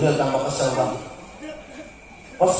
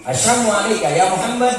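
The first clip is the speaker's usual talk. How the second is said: mocking greeting; 6 semitones higher, 4 dB louder than usual. The mocking unbelievers.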